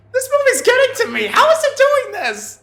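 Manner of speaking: high, very loud hammed-up panic; freaked-out yelling. Reviewer acting out a viewer stunned by twists, freaking out. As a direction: shout it way up high as if losing it. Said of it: "and you're like".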